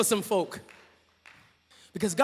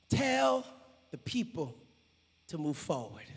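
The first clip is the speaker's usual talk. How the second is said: much lower and softer, deliberate; low calm command; 6 semitones lower, 8 dB quieter than usual. Acted. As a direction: speak it low and steady. Repeating God's line.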